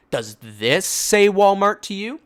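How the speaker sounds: mock her with the lanyard; imitated scornful mother; scornful question. Hero holds up the lanyard.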